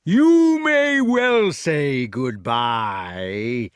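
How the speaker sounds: very slow, drawn-out giant's sneer; slow gloating sneer. A narrator's reading. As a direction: draw it out with menace, sneering. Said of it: "sneered the giant".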